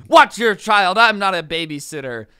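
loud irritated snap at a stranger; angry old man yelling. Retold or imitated. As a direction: bark it at the mom like a grumpy old man. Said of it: "the angry guy immediately snapped at this mom".

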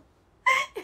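a very high, loud burst of laughter; shrieking laugh. Real, not acted. (甲高い大笑い)

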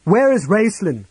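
loud, high, fierce demand; fierce cry. A narrator's reading. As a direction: shout it at the dragon. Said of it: "Sturm cried fiercely".

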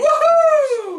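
high, loud woohoo; big drawn-out cheer; whooping cheer. Pretend. Cheering a game move.

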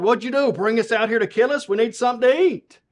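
fast, indignant acted protest; grumbling complaint. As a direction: complain like hungry, indignant Israelites. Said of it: "they say to Moses and God".

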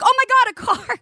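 high-pitched mock panic; retold alarm. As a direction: cry out as if a child is dashing into traffic. Retelling alarm at running kid.

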